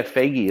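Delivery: "Feige" in two syllables, FAY-ghee; hard g and a final ee on "Feige"; FAY-ghee pronunciation. Shown as a demonstration. Co-host answering how the name is pronounced, "Feige" as FAY-ghee. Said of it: "is what I've heard".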